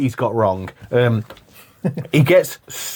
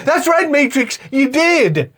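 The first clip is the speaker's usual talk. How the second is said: very high, slow mimicry; mocking Sully impression; 13 semitones higher, slower than usual. Host arguing Sully wouldn't say that, mock high voice. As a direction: exaggerate the villain's high voice. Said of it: "you wouldn't just".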